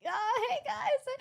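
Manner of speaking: high-pitched retold hello to the crowd; stage greeting. Nervous speaker greets the audience on stage, high mock greeting.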